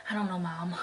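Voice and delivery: imitating a breathy sigh; mock breathy teen line; breathy Bella impression. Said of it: "she does that weird, like, breathy thing".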